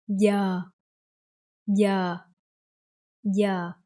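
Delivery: YA with an English Y sound; southern pronunciation. Shown as a demonstration. Teacher gives the third consonant, YA with a Y sound. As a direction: say YA alone, gently falling. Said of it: "It's like a Y sound".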